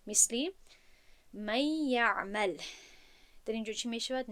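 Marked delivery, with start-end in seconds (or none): 1.3-2.6 slow «من یعمل» with nun merged into a long doubled Y. Shown as a demonstration. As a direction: glide through a held nasal Y in «من یعمل». («من یعمل» با ادغام نون در یا)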